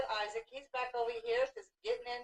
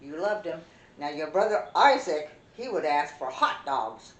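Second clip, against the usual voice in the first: ordinary delivery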